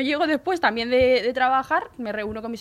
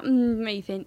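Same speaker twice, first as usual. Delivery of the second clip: normal talking voice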